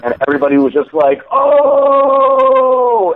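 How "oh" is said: much higher and louder, a shocked "Oh!"; crowd's gasp of surprise. Retold or imitated. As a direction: imitate onlookers reacting to a bold threat. Crowd reacts to the threat.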